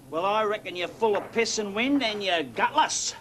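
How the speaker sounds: high-pitched, goading insult; scornful challenge. Acted. Pub regular calling a bragging stranger gutless, scornful and goading. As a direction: dare him with open contempt, slowing on the insult. Insults the man to force bet.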